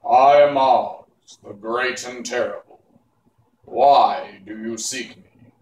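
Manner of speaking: loud, slow, solemn voice from above; solemn booming Oz. Acted. The hidden wizard greets the travelers, loud and slow. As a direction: boom it down like a giant. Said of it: "it said solemnly".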